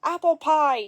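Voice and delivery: loud, high accusing shout; furious shout. A narrator's reading. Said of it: "she shouted".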